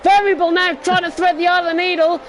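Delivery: rising, loud race-call excitement; excited commentary. Real, not acted. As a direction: call the three-wide chaos urgently. Sim racing commentary, chaotic three-wide battle.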